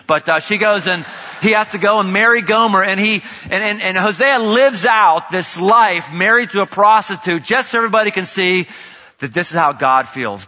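high, loud, shouting sermon delivery; preaching. Acted. Pastor telling the Hosea story, shouting sermon voice.

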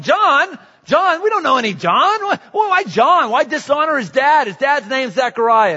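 high, loud disbelieving cries of John; shocked crowd. Retold or imitated. Relatives protesting the baby's name, high, loud and indignant. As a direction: protest as if the name were outrageous.

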